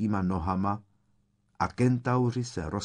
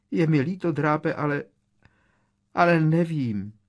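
high, louder, anxious apology; frightened squeak; 7 semitones higher, 5 dB louder than usual. A narrator's reading. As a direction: plead in a high, scared voice. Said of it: "pípla vyděšeně".

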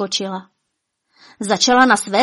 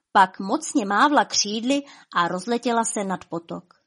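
ordinary delivery

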